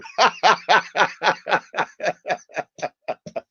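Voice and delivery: high, loud staccato laughing fit; real belly laugh, trailing off gradually; long laugh. Real, not acted. Host reading a funny chat comment, long real laugh.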